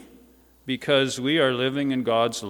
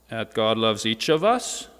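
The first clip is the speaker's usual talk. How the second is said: ordinary delivery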